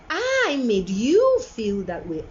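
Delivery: slow, with "I" and "you" drawn out; owning the blame, heavy emphasis on "I" and "you"; stress on I and YOU. Shown as a demonstration. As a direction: stretch "I" and punch "you". Stressing who caused the feeling.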